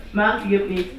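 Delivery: ordinary delivery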